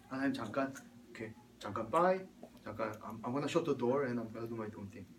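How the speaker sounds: low, hushed mock excuse; soft sneaky excuse. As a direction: slip away quietly with a soft, slow aside. Acted slipping away from God. (슬쩍 빠져나가듯 낮고 조용하게)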